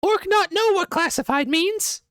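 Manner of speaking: very high, loud squeaky orc voice; a dim-witted orc, puzzled. Acted. GM voices the orc admitting confusion, high squeaky voice.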